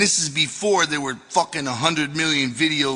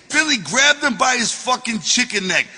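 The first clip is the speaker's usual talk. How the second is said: ordinary delivery, nothing marked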